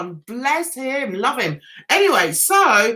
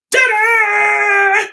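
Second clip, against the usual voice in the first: high, loud, long-held fanfare; triumphant ta-da; 8 semitones higher, 5 dB louder than usual. Retold or imitated. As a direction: belt out a big showy reveal. Re-enacting showing off her t-shirt.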